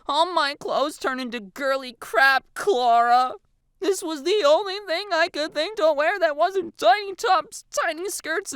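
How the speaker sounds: high, loud drawn-out complaint; exasperated whining. A narrator's reading. Girl to friend about clothes, exasperated. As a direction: wail each grievance in a high voice.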